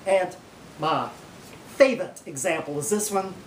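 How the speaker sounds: ordinary delivery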